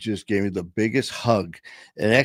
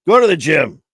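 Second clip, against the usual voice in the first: emphatic call; 6 semitones higher, 9 dB louder than usual. Real, not acted. Emphatic closing punchline.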